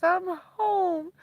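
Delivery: mock tearful friend; retold crying friend. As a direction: imitate a friend crying down the phone. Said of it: "she's crying on the phone".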